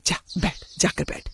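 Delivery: hushed, insistent whisper; whispered urging. Acted. Parent privately telling son to sit with his aunt, whispered urging.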